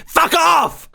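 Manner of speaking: high-pitched cry to get away; yelled rebuff. Acted. Pocket rebuffing the pursuing Lycan, high yelled brush-off. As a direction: yelp it back at a pursuer.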